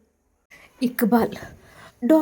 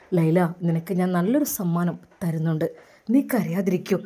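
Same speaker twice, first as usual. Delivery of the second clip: put-on lower, gentle young man's voice; lower, tender groom talking to his bride-to-be; the groom's voice; 7 semitones lower than usual. A narrator's reading. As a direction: speak as the suitor who wins her over. Doctor comforts crying bride.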